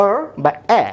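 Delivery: অ বা এ as isolated open "aw" or "ae"; short A sound. Shown as a demonstration. Teacher giving A's short sound, এ open and isolated. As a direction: give এ as an open "ae". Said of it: "এই এর short sound হচ্ছে".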